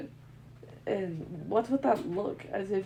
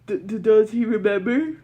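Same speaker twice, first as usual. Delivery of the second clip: panicked stammer; 10 dB louder than usual. Acted.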